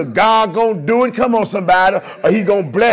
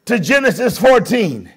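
full-voiced, measured preaching; 4 semitones higher, 5 dB louder than usual. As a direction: proclaim it from the pulpit, slow and strong. Preacher opening with scripture.

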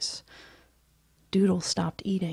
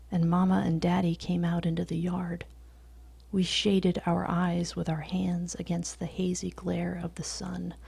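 ordinary delivery

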